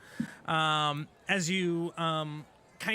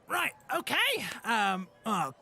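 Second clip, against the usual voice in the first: put-on elderly goblin; slower than usual. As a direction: play the doddering old goblin clerk. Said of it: "He goes".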